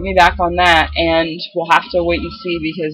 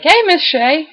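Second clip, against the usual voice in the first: higher-pitched imitation of a coworker's greeting; coworker's friendly hail; 10 semitones higher, 4 dB louder than usual. Coworker greeting the speaker as a term of endearment, imitated high hail. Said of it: "they'll come up and they'll be like".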